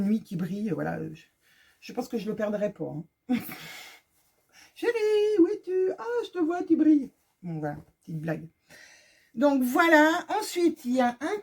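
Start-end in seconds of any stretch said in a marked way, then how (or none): 4.7-7.1 high, sing-out mock search for a sweetheart; playful calling out. Wife jokingly looking for her husband in shiny shorts, playful calling. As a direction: call out teasingly in a higher voice.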